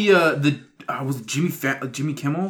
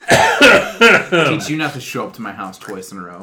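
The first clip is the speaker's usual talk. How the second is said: mock threat; 9 dB louder, slower than usual.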